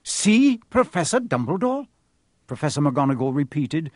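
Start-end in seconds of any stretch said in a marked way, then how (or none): 0.0-1.9 higher, rising incredulous echo; suspicious. Acted. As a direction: repeat it as if it smells fishy. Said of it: "as though this was a very fishy thing".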